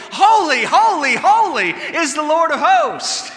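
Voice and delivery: loud, high holy cry; angelic cry. Acted. Preacher voicing heaven's angels, loud proclamation. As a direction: call it out like the angels. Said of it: "never cease day and night to say".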